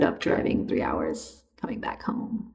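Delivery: breathy whisper, like a secret; whispered aside. Acted. Vlogger whispering to viewers about the long drive home. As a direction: drop into a hushed whisper to tease the warning.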